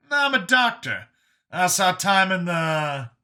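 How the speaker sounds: high, loud character voice; Brian explaining himself. Acted. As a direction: pitch it up, then draw it out to a halt.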